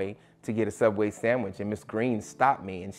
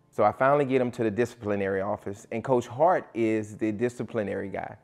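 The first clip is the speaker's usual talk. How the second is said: ordinary delivery, nothing marked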